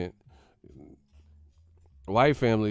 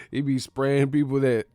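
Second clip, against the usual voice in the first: ordinary delivery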